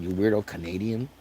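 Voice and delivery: ordinary talk, nothing marked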